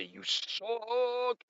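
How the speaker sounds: high, drawn-out mock heckle; mock yell. A fan yelling at the host, high mock yell. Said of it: "somebody to yell at and say".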